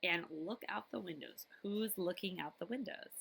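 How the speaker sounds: ordinary delivery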